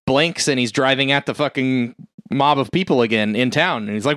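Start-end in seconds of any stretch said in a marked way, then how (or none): none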